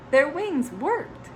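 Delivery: ordinary delivery, nothing marked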